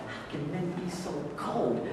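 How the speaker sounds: mock lament. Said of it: "you actually hear young women talk about".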